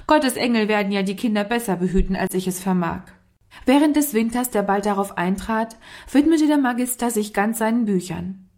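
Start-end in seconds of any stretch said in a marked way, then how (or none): none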